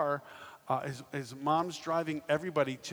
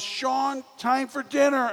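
much higher, louder sing-song call; mom calling; 10 semitones higher, 8 dB louder, slower than usual. Retold or imitated. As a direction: mom calls Sean down to dinner, high and loud.

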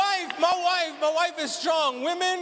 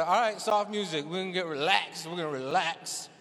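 low, softening relaxation voice, sinking lower; soothing calm voice; 9 semitones lower, 3 dB quieter than usual. Acted. Husband soothing wife about soft music, low and calming. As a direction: lull her like a spa tape. Mock soothing for birth playlist.

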